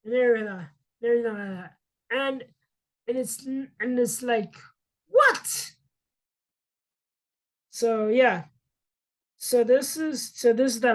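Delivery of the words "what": very high, baffled mock what; squeaky what. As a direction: squeak it in disbelief. Baffled reaction in retelling.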